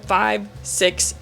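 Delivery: ordinary talk, nothing marked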